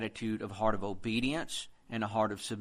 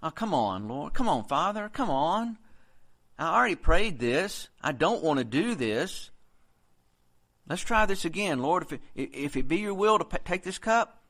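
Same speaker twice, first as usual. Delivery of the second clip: higher, louder, whiny pleading to God; mock whining; 5 semitones higher, 4 dB louder than usual. Preacher mimics a complaining prayer, higher and whiny. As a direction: whine like a spoiled child begging the Lord. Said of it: "start whining and complaining".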